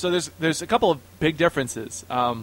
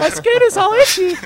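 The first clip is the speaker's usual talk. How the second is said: put-on squeaky little girl voice; 10 dB louder than usual. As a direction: play a whiny little girl, pitched way up. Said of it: "Would I talk in a squeaky voice".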